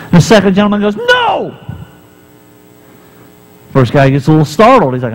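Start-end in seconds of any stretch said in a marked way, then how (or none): 1.0-1.5 panicked no. Acted. Friend stops him lighting flashlight.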